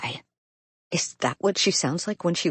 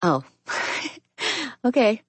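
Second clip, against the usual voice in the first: light laugh through the words; 10 semitones higher than usual. A narrator's reading. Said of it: "she laughs".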